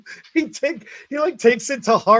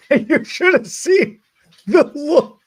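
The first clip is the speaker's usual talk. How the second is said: laughing; 5 semitones higher, 5 dB louder than usual. Real, not acted. Cracking up recalling his reaction.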